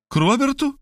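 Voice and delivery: high, incredulous question; amazed question. A narrator's reading. Maria joins in the amazement, high and incredulous. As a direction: join in the disbelief. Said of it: "присоединилась к ней Мария".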